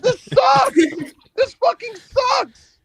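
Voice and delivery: high, loud complaining yell; mock outrage. Reacting to the bad match, in a high mock outraged yell. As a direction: rant it out high and slow. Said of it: "I was like".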